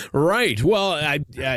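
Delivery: ordinary talk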